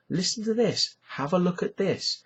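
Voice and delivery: high-pitched, lightly acted enthusiasm; mock eager sharing. People share things with friends, mock eager. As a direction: imitate a friend keen to share a find.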